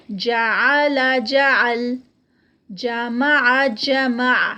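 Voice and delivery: جعل and جمع with a loud, prominent throaty ع; loud recitation. Shown as a demonstration. Teacher drilling ain in جعل and جمع. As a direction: recite each twice, pressing the ع. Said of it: "عین جو ہے وہ دونوں میں پرومیننٹ ہو".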